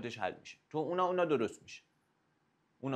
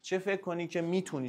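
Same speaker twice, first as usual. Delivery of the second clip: ordinary talk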